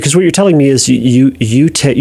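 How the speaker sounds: usual speaking voice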